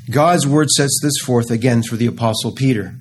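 normal talking voice

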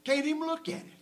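high-pitched disbelief; incredulous. Real, not acted. Amazed emphasis on scripture just read.

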